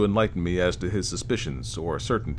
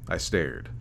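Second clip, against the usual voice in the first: ordinary delivery, nothing marked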